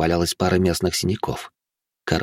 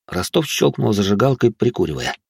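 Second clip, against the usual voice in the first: plain reading voice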